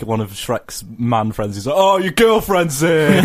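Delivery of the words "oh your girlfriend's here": mocking ogre dad. Abusive dad mocking Shrek.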